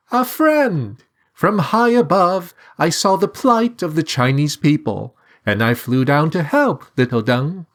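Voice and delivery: cheerful high sing-song; sing-song bird. A narrator's reading. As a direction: sing it down from the sky. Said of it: "sing-song reply".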